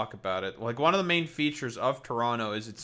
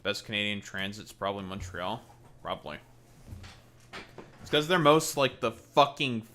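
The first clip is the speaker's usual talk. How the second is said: usual speaking voice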